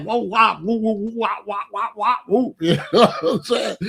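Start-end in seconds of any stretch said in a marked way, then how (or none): 0.0-2.6 mocking whoa wah chant. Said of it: "I was like".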